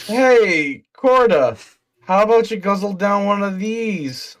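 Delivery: high, loud, eager offer; high excited character voice. Acted. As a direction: call out brightly in character.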